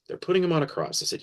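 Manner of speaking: ordinary talk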